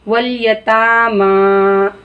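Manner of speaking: वल यतामा with ता and मा stretched long; slow demo stretching the vowels of यतामा; drawn long vowels. Shown as a demonstration. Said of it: "हम ता को खींच रहे हैं".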